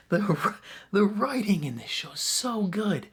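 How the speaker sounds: high, delighted gush about the writing. Real, not acted. Fan gushing to viewers about the writing, excited. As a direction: rave like a thrilled fan.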